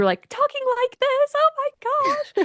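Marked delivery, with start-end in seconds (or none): 0.3-1.3 squeaky-high imitation of someone's old voice; exaggerated high-pitched voice; higher voice. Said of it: "like, a higher voice". 1.3-2.3 very high imitated squeal of dismay; exaggerated high-pitched exclamation; mock high voice. Mimicking the old high voice.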